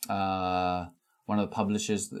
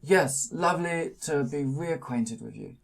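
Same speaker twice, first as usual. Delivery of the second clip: put-on small old man voice; 7 semitones higher than usual. GM voices Charles Adams.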